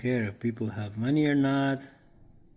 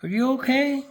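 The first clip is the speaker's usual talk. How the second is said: very high, louder squeaky question; squeaky pet talk; 6 dB louder than usual. Real, not acted. Cooing at pet Peter.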